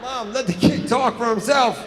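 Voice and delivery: loud exasperated shout; shouting at mom. Acted. Son fed up with his bragging mom, shouting. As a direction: yell at her to let him speak.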